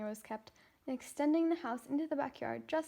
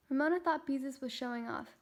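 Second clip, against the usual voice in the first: plain reading voice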